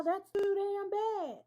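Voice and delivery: put-on high, slow cranky old man; grumpy grandpa voice. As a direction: growl it like a stubborn grandpa. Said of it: "that's what I see".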